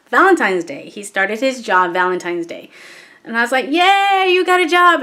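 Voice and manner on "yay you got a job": excited cheer. Retold or imitated. Mom cheering her son's new job, high and excited. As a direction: cheer for good news in a raised pitch. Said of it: "I was excited for him".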